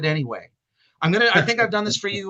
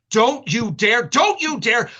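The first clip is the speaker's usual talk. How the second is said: higher, louder, sharper with each go; building scolding; 6 semitones higher, 6 dB louder, slower than usual. Retold or imitated. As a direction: scold a naughty cat, rising each time. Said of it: "this is verbatim, say this".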